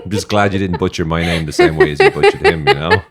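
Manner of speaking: loud laughing talk. Real, not acted. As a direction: talk through a big laugh.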